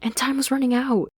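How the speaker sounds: high, insistent Anne voice; Anne arguing. Acted. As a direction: press the point as the younger friend. Anne's voice, arguing.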